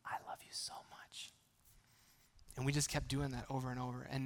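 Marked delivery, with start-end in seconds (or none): 0.0-1.3 soft unvoiced whisper in a child's ear; father whispering I love you to his toddler. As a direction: breathe it like a secret to a little girl. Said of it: "I'd get to whisper in her ear".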